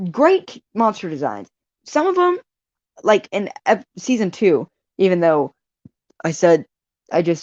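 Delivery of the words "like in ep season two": ordinary delivery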